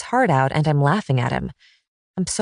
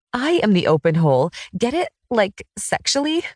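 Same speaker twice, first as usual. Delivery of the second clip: laughing; 5 semitones higher than usual. A narrator's reading. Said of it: "this is making me laugh so hard".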